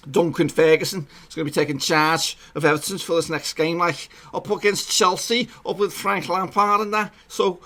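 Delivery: high-pitched Scouse accent impression. Vlogger imitating an Everton fan asking about the new manager, Scouse accent. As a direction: put on your best Scouse. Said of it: "That's my best Scouse accent".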